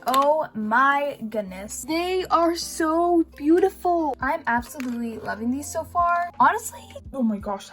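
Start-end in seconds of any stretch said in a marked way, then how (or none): 0.1-4.1 slow, amazed gushing; drawn-out awe. Real, not acted. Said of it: "all I can say is". 6.4-7.7 voice crack. Real, not acted. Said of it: "that voice crack".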